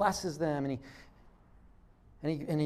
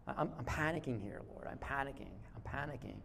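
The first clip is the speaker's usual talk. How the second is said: quiet mock panic; 4 semitones lower, 11 dB quieter than usual. Acting out disciples' panic.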